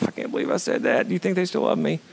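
soft, self-doubting question; hushed mock worry; sheepish aside. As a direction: drop the volume, as if privately asking his wife. Acts asking his wife at home.

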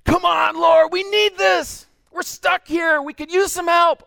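high, loud pleading shout; shouting prayer like a fan. Pretend. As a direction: cheer God on like your team. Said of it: "screaming my fool head off in worship".

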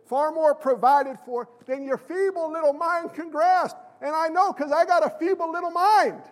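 slow, emphatic preaching that climbs in pitch; rising pulpit crescendo; impassioned preaching. Real, not acted. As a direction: build phrase by phrase toward a fervent peak.

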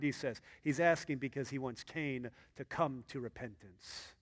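ordinary talk, nothing marked

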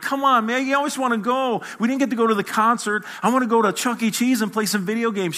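put-on high, pleading whine of a spoiled boy; whiny kid. Boy whining to his babysitter about Chuck E. Cheese, high whine. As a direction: whine it like a ten-year-old denied a treat. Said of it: "I whined. I cried".

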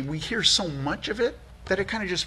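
ordinary delivery

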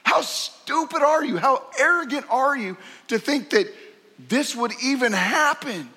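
father's incredulous scolding; emphatic scorn. Acted. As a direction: tear into his arrogance. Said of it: "this is emphatic".

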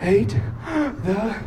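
one word at a time between sobbing gasps; imitated sobbing child. Father imitating daughter's fear of seeds growing, gasping sobs. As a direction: hiccup through it like a child crying hard. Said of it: "big sobs for several minutes".

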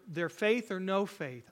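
usual speaking voice

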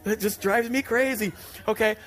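mock-furious driver rant; worked up. Real, not acted. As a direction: let the road rage boil over, half laughing at yourself. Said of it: "I'm getting all worked up".

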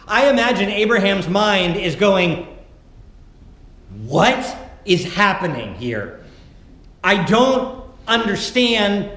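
loud, impassioned sermon; preaching. Real, not acted. Pastor pressing the congregation on Abraham's trust, impassioned preaching. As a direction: drive the questions home to the congregation.

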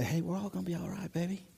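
suddenly soft, quick calming words; soothing reassurance. Acted. Pastor calming frantic people, softly reassuring. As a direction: drop right down to soothe someone panicking. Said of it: "I literally have to pastor people".

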